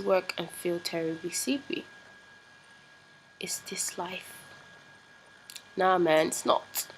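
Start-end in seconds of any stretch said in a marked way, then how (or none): 3.4-4.4 breathy whispered question. Real, not acted. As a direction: whisper it to yourself. Whispered aside.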